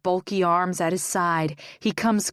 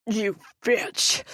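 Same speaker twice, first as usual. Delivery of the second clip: gurgling; 3 semitones higher than usual. Acted. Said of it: "he gurgles the words".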